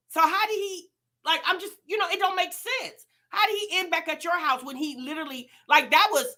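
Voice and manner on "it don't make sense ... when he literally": ordinary talk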